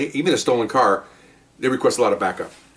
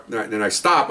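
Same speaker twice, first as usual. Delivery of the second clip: normal talking voice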